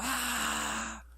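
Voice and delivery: soft, high, drawn-out "aah"; imitated scream. (grito fingido, largo, agudo y suave)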